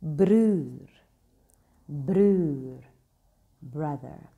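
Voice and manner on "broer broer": broer drawn out slow and loud, rolled r; Afrikaans broer. Shown as a demonstration. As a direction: stretch broer out, BROO-er. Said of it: "You've got".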